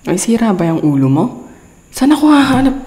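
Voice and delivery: exasperated outburst. Real, not acted. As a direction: vent at the absurd request, voice climbing. Frustrated by impossible food request. (naiinis na sigaw, pataas ang boses)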